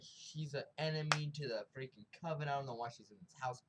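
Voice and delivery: ordinary delivery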